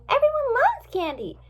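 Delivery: put-on very high girlish voice; high, squeaky exclamation; baffled friend. A narrator's reading. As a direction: protest in high-pitched disbelief. Friend baffled that bribe failed.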